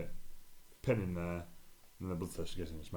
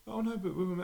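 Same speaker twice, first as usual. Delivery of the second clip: protesting mate; 11 semitones higher, 3 dB louder than usual. Retold or imitated. Mate protesting in retold story.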